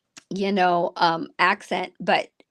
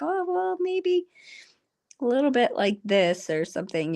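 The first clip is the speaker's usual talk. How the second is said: light mock; 4 semitones higher than usual. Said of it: "It's like".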